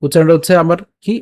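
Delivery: normal talking voice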